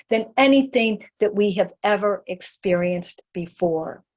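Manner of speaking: normal talking voice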